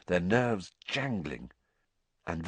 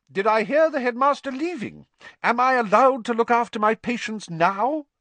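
much higher, louder cross matron voice; snippy, put out; 13 semitones higher, 5 dB louder than usual. A narrator's reading. Madame Pomfrey scolding about her patients, crossly. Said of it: "She was in a very bad mood".

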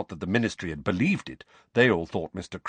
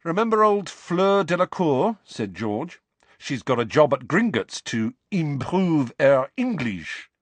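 higher, louder gossipy character voice; animated, knowing brotherly banter; teasing George; 7 semitones higher, 4 dB louder than usual. Acted. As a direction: share juicy gossip with a teasing lift.

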